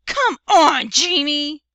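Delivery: loud, exasperated mom; angry. A narrator's reading. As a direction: shout the boy down. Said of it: "Mom said angrily".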